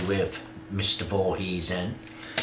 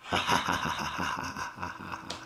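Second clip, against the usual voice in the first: soft evil chuckle; 3 semitones lower, 10 dB quieter than usual. Acted. Evil laugh after the warning.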